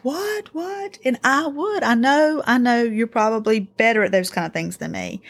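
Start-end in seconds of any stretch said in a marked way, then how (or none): 0.0-1.0 put-on clueless kid. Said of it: "and he's like".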